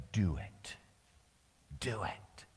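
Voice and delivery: low firm urging that drops into a whisper; Jesus pressing him, softening to a whisper; urging, fading to whisper. Acted. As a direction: say it once low, then whisper it.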